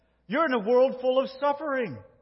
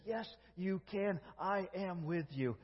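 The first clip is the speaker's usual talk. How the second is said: gentle reassurance; 2 semitones lower, 12 dB quieter than usual. Retold or imitated. God reassuring his frightened Son, soft and tender. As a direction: hush it, tender and sure.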